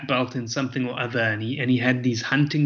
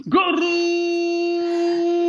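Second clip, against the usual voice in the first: fast mock-Japanese call leaping high on the goal; Japanese-style play-by-play with a stretched goal cry; Japanese soccer commentary; 4 dB louder than usual. Shown as a demonstration. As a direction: commentator exploding into a long goru. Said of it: "my favorite was Japan".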